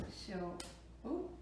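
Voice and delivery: ordinary talk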